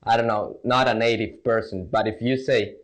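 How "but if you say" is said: ordinary talk, nothing marked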